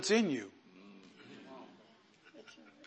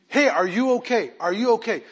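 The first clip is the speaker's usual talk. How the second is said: retold alarm; 5 semitones higher, 5 dB louder than usual. Acts worried husband on phone.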